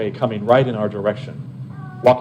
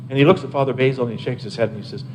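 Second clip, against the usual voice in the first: normal talking voice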